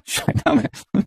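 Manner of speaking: breathy laugh through the words; mocking laugh at eating in China. Real, not acted. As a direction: laugh it out in disbelief.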